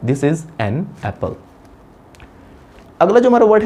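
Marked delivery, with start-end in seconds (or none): 0.1-1.3 slow, softer, "an" as full "ann" apart from "apple"; "an" unreduced and unlinked from "apple"; careful writing pronunciation. Shown as a demonstration. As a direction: separate "an" from "apple", word by word. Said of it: "writing sound क्या हमारा".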